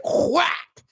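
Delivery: high mock whack noise; a sharp high onomatopoeic whack; whack sound effect. Imitating the phone hitting the ground, high.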